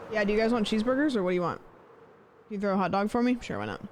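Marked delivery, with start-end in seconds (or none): none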